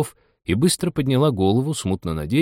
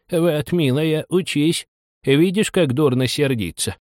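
lightly voiced, higher-pitched moralising crab; old mother crab; 6 semitones higher, slower than usual. A narrator's reading. Old crab lecturing her daughter, mildly moralising.

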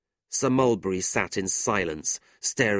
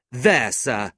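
raised, loud retort; indignant; 4 semitones higher, 7 dB louder than usual. A narrator's reading. Brother throwing his card at man, indignant. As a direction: throw it down at him, sharply raised.

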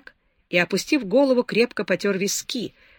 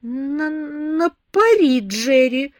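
slow, high, tearful на пари, held-back sobs; holding back tears; 7 semitones higher, 4 dB louder, much slower than usual. A narrator's reading. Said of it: "удерживая слёзы, прошептала она". (сдерживая слёзы, медленно)

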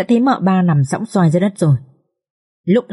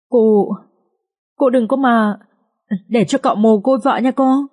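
higher-pitched, whiny pretend sobbing over her mistress; mock whimpering; 4 semitones higher than usual. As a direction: wail it in a lightly voiced tearful maid's plea. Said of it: "khóc thút thít". (con Hảo khóc thút thít van cô đừng chết, giọng cao)

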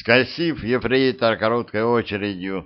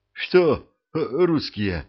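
frightened mumble; 4 semitones higher, slower than usual. A narrator's reading. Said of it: "пробормотал тот, испуганно".

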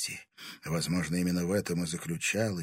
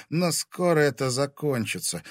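ordinary delivery, nothing marked

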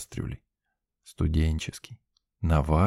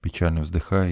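plain reading voice

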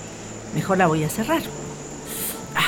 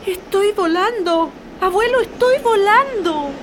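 excited; 10 semitones higher, 4 dB louder than usual. Acted. Girl flying on the wind.

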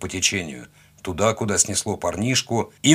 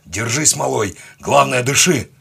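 raised, urgent bark building louder; barked in his ear; 4 semitones higher, 5 dB louder than usual. A narrator's reading. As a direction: urge him loudly to hold on. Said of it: "Я рявкнул прямо ему в ухо". (рявкнуть прямо в ухо)

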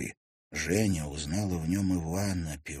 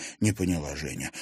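plain reading voice

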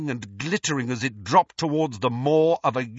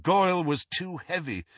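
ordinary delivery

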